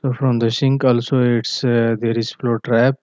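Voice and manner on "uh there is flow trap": usual speaking voice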